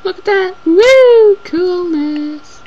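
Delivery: high, loud whoop of delight; excited. Real, not acted. Thrilled at something she sees onscreen, whooping. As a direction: cheer at the cool thing.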